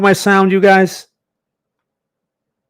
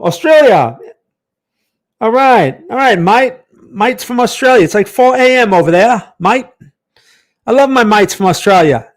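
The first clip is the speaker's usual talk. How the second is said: higher, louder mock-Aussie voice; Australian accent; 6 semitones higher, 6 dB louder than usual. Trader greeting mates from Australia, hammed-up Australian accent. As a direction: put on a playful Australian accent. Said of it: "It's my Australian accent".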